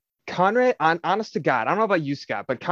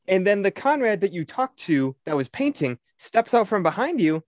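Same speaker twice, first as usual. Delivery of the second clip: ordinary talk, nothing marked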